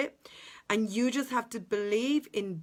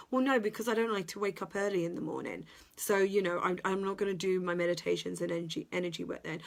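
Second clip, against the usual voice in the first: rushed excuses; faster than usual. Retold or imitated. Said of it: "imagined excuse voice".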